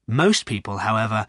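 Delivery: usual speaking voice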